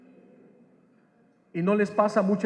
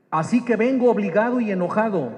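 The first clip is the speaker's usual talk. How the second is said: raised-voice, indignant retelling; retold anger; 4 semitones higher, 6 dB louder, faster than usual. Preacher voices the young man forced to come by his grandmother, retold anger. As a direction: imitate an annoyed young man, louder and higher. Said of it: "venía más enojado".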